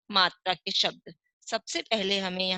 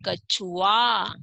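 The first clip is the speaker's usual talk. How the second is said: कछुआ slow with a falling end; slow model reading; 3 dB louder, much slower than usual. Shown as a demonstration. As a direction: stretch कछुआ out for the class. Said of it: "आगे चित्र दे रखा है हमें".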